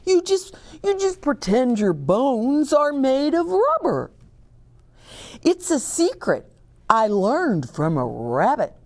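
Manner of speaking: high bear, confiding a trick. Acted. Bear tells Milo his rubber-bones secret.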